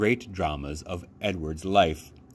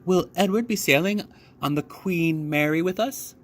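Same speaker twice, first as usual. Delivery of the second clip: put-on high child voice; 10 semitones higher than usual. A narrator's reading. Child asks at dinner.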